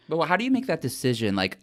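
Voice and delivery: ordinary talk, nothing marked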